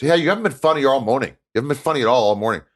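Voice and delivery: high, loud mock caller whine; caller's complaint. Caller phoning in to say the show's unfunny, mock whiny. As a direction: heckle the host like a fed-up listener. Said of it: "He goes".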